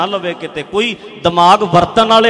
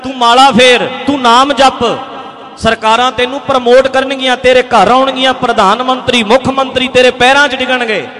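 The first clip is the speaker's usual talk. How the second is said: loud, insistent acted rebuke; forceful mimicry of a critic's advice; mock scolding; 6 dB louder than usual. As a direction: hector him in a raised voice, piling up urgings. Acted quote scolding the preacher's activism.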